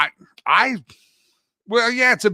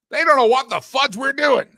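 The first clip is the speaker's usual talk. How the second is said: mock outrage; 9 semitones higher, 4 dB louder than usual.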